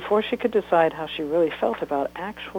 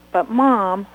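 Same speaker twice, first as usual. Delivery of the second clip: protesting; 5 semitones higher, 9 dB louder than usual. Acted. Child protesting.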